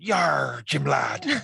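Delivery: growled West Country pirate cry; drawn-out pirate "yar" in a West Country accent; Long John Silver. Acted. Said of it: "Long John Silver a-, approximated my kind of accent".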